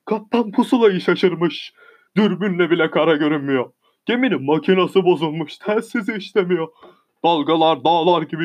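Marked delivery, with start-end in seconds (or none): none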